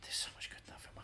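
hushed, voiceless aside; whisper. Real, not acted. Frustrated friend whispering about his favourite film. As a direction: breathe it under your breath. Muttering frustration mid-argument.

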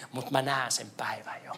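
hushed whisper of a vision; soft whispered promise. Acted. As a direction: breathe it like a secret hope. Vision, then repeated aloud.

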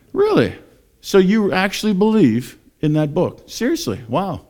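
high-pitched mock amazement; incredulous mocker. Listener doubting the preacher's book, mock incredulous. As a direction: act floored that anyone believes it. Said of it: "kinda like".

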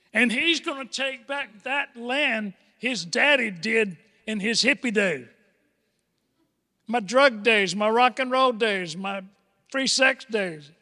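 usual speaking voice

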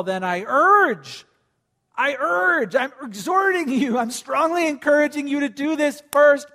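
building to a shout; exhorting. Acted.

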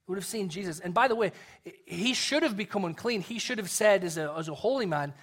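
normal talking voice

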